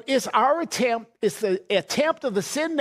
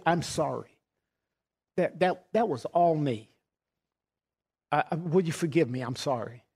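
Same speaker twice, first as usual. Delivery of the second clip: tearful apology; 6 semitones lower, 4 dB quieter than usual. Retold or imitated. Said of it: "you're crying".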